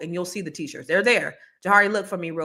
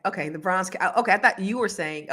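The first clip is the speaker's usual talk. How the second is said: ordinary talk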